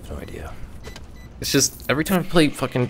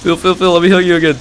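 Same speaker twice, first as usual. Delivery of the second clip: urgent call; 8 semitones higher, 6 dB louder, faster than usual. Real, not acted. Urgently calling teammate.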